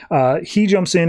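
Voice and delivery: ordinary delivery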